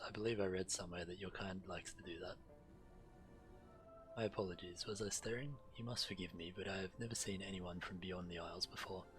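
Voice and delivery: normal talking voice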